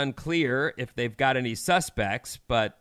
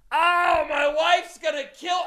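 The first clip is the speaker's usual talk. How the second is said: frantic husband dreading his wife; panicked dad; 12 semitones higher than usual. Retold or imitated. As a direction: yelp it like a dad who knows he's in trouble. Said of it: "is now going".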